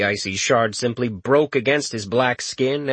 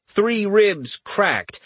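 plain reading voice

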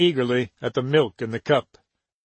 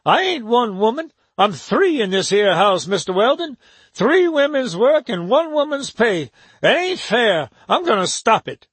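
put-on complaining old servant; 8 semitones higher than usual. A narrator's reading. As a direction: grumble like a worn-out housekeeper. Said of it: "she complained".

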